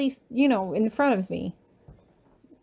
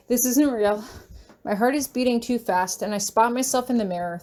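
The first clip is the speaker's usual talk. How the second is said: usual speaking voice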